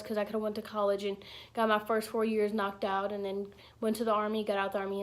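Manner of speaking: usual speaking voice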